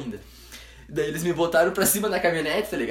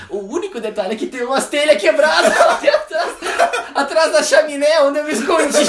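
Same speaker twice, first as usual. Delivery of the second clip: repete a fala do tio rindo, agudo e alto; 11 semitones higher, 8 dB louder than usual. Retold or imitated. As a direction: quase sem conseguir falar de tanto rir. Tio revela as telhas quebradas. (citando o tio às gargalhadas)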